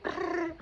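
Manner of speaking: high playful lip trill; silly brr noise. Retold or imitated. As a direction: make a childish brr sound. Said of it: "making all these noises".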